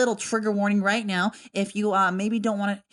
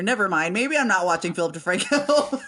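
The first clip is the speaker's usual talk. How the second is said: ordinary talk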